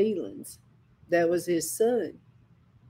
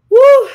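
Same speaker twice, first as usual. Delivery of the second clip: sudden shrieked "woo"; loud high whoop; 17 dB louder than usual. Real, not acted. Preacher recalls crying under God's rebuke, loud high whoop. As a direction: let out one piercing whoop.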